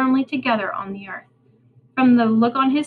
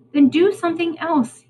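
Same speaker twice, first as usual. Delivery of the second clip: ordering; 7 semitones higher, 3 dB louder than usual. Acted. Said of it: "he ordered".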